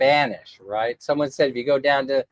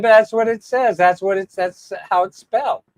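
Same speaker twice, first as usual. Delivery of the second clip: higher, mildly insistent comeback; retold protest; 5 semitones higher, 3 dB louder than usual. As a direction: replay your own puzzled objection, pitched up. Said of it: "I said".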